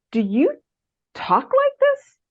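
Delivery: mentor's challenge. Retold or imitated. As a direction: raise the voice way up, drawing out the doubt. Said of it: "And he kept saying".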